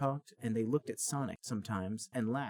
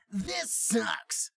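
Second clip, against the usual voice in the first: mock scream; 11 semitones higher, 4 dB louder than usual. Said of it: "He screamed".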